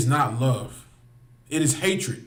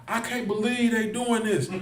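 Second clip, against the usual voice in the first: high, mocking put-on shock; mock surprise; 6 semitones higher, 3 dB quieter than usual. Mocking a surprised onlooker, high mock disbelief. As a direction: mimic her fake disbelief, pitched up. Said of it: "who's acting surprised".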